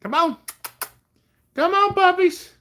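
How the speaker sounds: high excited pet talk; calling the dogs. Real, not acted. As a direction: coax the puppies over, sing-song.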